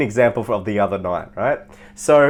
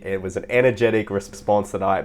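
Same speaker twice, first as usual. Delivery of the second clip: normal talking voice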